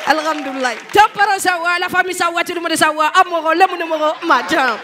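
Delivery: chanted Malian greeting litany; beaming mock-Malian greeter; smiling Malian accent. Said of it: "les Maliens, quand ils parlent".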